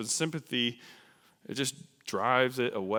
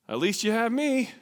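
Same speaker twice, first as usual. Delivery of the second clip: minimizing; 10 semitones higher, 5 dB louder than usual. Acted. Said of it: "minimize it with ... at least".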